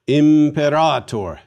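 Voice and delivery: Imperator slow and loud, im-peh-RAAH-tor; long A. Shown as a demonstration. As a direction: model "Imperator" firmly, stretching the stressed A. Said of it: "say commander in Latin".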